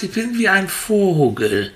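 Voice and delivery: high, mock joyful call; excited. Guide voices the child calling out while flying, excited. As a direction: call it out like a happy bird. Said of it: "und schreist".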